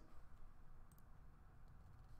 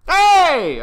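sudden loud shout of hey; 13 semitones higher, 15 dB louder than usual. Real, not acted. Vlogger shouting at the camera, loud. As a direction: yell it out to grab attention.

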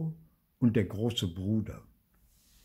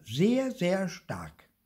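put-on slow, drawn-out, higher child voice; emphatic boast; 11 semitones higher, 4 dB louder, much slower than usual. A narrator's reading. Theo boasting about his brother, slow and higher. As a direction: stretch each word proudly.

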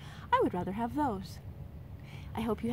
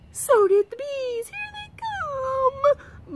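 much higher and louder, crying out, slowing on the end; frightened moan; 8 dB louder, much slower than usual. Acted. Arthur as the bees follow inside, crying panicked wail. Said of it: "moaned Arthur".

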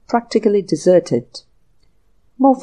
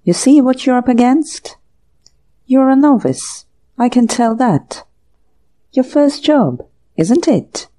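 almost genial; 5 semitones higher, 5 dB louder than usual. Acted. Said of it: "said the other almost genially".

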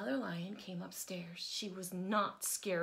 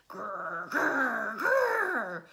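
building roar; 2 semitones higher, 11 dB louder, much slower than usual. A narrator's reading. Johnny Lion scaring off the big thing, roaring loudly. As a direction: roar louder each time.

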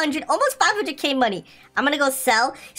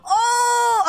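high, drawn-out wail of dismay; dismayed cry; 11 semitones higher, 3 dB louder than usual. Real, not acted. Gamer just missing the lucky number, dismayed cry. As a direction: cry out high as it slips away. Overshoots the number he was chasing.

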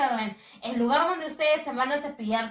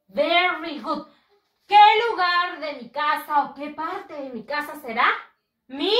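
normal talking voice

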